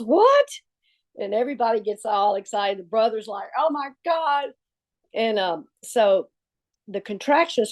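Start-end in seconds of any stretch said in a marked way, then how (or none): 0.0-0.5 very high-pitched shocked yelp. Retold or imitated. Michelle hearing of the labor, high shocked cry. 3.6-4.5 higher-pitched stunned exclamation; imitated astonishment. Brother learning she is in labor, higher astonished voice. Brother's retold excited reaction.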